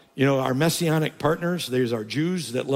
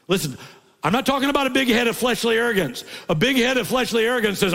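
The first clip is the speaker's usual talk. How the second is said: high, loud preaching; full-force preaching; 11 semitones higher, 4 dB louder than usual. Acted. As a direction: drive it home to the church.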